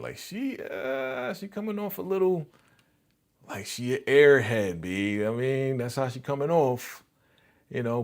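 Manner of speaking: ordinary talk, nothing marked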